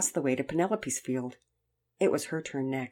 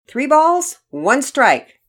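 loud, raised calling of the count; umpire's yell; 9 semitones higher, 12 dB louder, much slower than usual. A narrator's reading. Umpire gives the count, loud high yell. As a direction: yell it to the whole ball field. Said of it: "The umpire yelled out the count".

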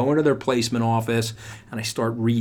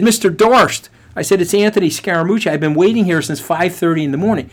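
acted call across a lobby, voice raised; 6 semitones higher, 6 dB louder than usual. Waiting student to arriving recruiter, acted loud hail. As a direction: hail someone urgently, loud and high.

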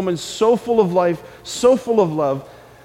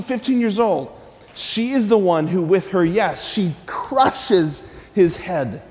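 ordinary talk, nothing marked

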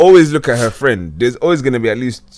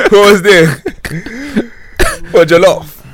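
high laughing tease; 8 semitones higher, 5 dB louder, slower than usual. Real, not acted. Teasing about the Nigerian corner.

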